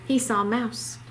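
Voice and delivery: plain reading voice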